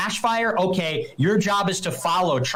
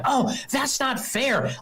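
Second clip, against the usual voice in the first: high, slow whine mocking a crybaby; mock whine that the game isn't fair; whiny crying voice; 7 semitones higher, slower than usual. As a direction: whimper it like a sulking child. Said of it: "I'm not crying like".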